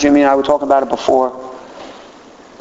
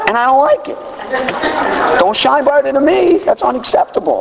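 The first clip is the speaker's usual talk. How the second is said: mock diva; 8 semitones higher than usual. Mock diva wanting the spotlight.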